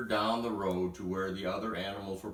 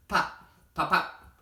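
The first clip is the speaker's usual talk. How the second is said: popping sounds; 8 semitones higher, 5 dB louder than usual. A narrator's reading. Narrating popping corn.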